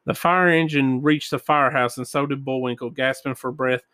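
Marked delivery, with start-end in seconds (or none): none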